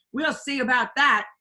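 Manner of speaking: defiant cry. A narrator's reading. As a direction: warn the villain off. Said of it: "cried Pippi".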